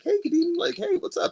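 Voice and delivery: high-pitched friendly hello; friendly greeting. Retold or imitated. Ex's friend greeting him at the bar, high mock-friendly.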